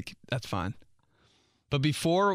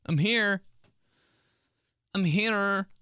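higher, eager repeated call; mock eager arrival; 9 semitones higher, slower than usual. Late yoga joiner cheerfully announcing arrival.

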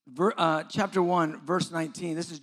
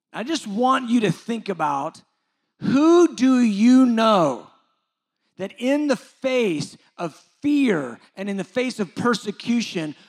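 loud, raised preacher's challenge; impassioned preaching; 5 semitones higher, 7 dB louder than usual. Real, not acted. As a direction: press the congregation with forceful, ringing questions.

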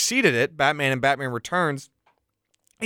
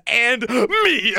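higher, louder over-the-top "And me."; hammed-up villain line; 7 semitones higher, 3 dB louder than usual. Host mocks Two-Face leaping in with a hammy, higher "And me.". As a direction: pop out with a goofy "And me.". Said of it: "he just jumps out and".

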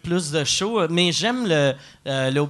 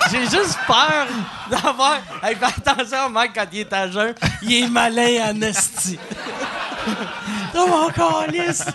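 laughing; 7 semitones higher than usual. Real, not acted. Laughing at his own joke.

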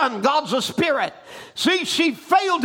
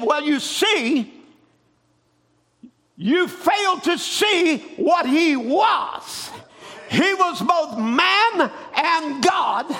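slow deliberate reply; 5 semitones higher, much slower than usual. Retold or imitated. Quoted reply, slowed.

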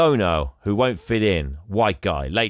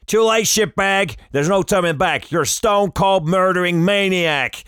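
sheriff yelling; 9 semitones higher, 5 dB louder than usual. Retold or imitated. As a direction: snarl and bellow the accusation. Sheriff berating the killer.

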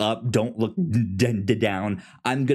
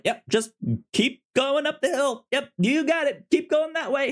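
high, breezy guide voice, much higher than his own; chipper Benji. Retold or imitated. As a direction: play Benji cheerfully steering him along. Voicing Benji guiding Ethan.

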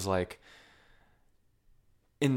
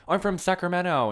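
high, loud girlish mock line; imitating Lady Bird; 10 semitones higher, 5 dB louder than usual. Lady Bird telling the party guy where she's from, high and bright. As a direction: chirp it out like a hopeful teen. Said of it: "where she's like".

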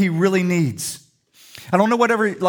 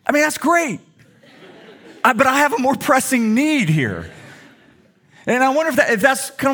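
laughing; 8 semitones higher than usual. Real, not acted. Laughing at daughter's wrench.

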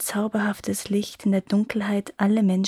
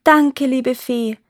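grateful call; 4 semitones higher, 6 dB louder, slower than usual. A narrator's reading. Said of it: "riefen Bianca und Bernarda".

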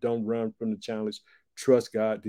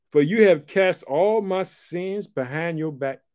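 slow, weighty pulpit reading; slow scripture reading; 4 semitones higher, 4 dB louder, slower than usual. Acted. Preacher reads the verse on forgiveness to the congregation, slow and weighty. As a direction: lay each word down deliberately.

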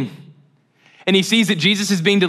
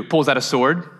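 usual speaking voice

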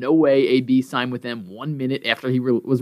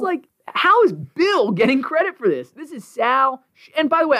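real indignation, pitched way up; fired up; 11 semitones higher than usual. Real, not acted. [name] protests Bill getting credit, high indignant outburst. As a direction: protest hotly. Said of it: "I can tell".

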